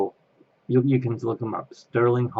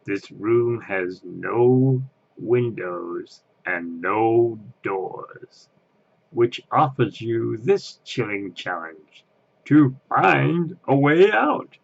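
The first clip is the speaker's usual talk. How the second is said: Ghost Host voice; much slower than usual. Retold or imitated. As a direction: menace the guests, rising at the end. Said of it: "the other part".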